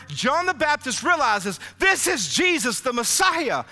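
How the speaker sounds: loud, high, fervent preacher's cry; preaching at full force. Acted. Pastor retelling Jesus's baptism to the church, crying out fervently. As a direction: lift the congregation as John recognizes the Messiah.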